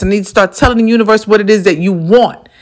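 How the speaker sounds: ordinary talk, nothing marked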